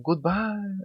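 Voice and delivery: calling goodbye. A narrator's reading. Spa owners waving to departing customers, high called goodbye. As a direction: sing it out as a farewell. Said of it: "waving to them and shouting".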